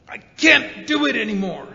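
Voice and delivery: a sudden loud cry of giving up; breaking point shout. Retold or imitated. The snapping point inside him, loud desperate cry. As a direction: let it burst out of you.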